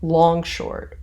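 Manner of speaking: "long short" with LONG punched, short falling away; weight on "long", let "short" drop; trochaic stress. Shown as a demonstration. Teacher explains a trochee to students, trochaic stress. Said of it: "is the reverse".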